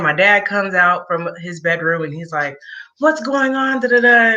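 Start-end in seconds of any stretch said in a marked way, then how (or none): 3.0-4.3 high-pitched mock alarm, getting louder; retold alarmed dad. Dad coming out of his bedroom, retold alarm. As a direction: imitate a startled demand, voice pitched up. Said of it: "all the screaming and hollering".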